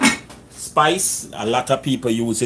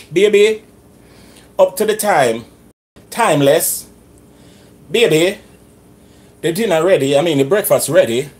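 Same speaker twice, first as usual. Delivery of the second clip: calling out; 5 semitones higher, 6 dB louder than usual. Real, not acted. Calling wife to breakfast.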